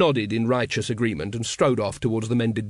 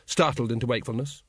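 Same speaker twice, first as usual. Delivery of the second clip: ordinary delivery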